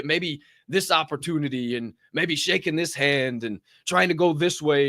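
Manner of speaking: ordinary talk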